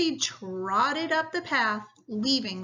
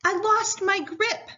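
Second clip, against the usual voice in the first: whimpered; 10 semitones higher than usual. Acted. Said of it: "Pat whimpered".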